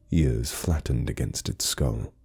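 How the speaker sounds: ordinary delivery, nothing marked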